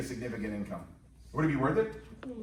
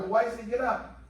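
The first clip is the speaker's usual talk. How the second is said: put-on high little kid voice; 8 semitones higher, slower than usual. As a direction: ask like a small boy. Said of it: "I go".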